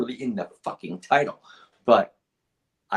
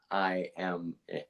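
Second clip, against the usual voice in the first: normal talking voice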